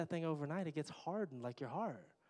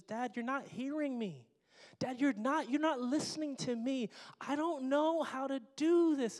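put-on high, rising whine of a frustrated kid; whiny daughter; 10 semitones higher, 5 dB louder than usual. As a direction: play the exasperated daughter, pitched way up. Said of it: "She says".

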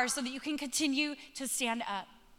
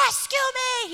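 mock cry for rescue; 10 semitones higher, 5 dB louder than usual. Mock plea from the boat.

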